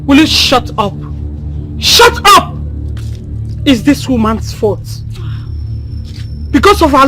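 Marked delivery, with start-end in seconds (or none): 1.8-2.6 sharp shout. Acted. Mother silencing daughter.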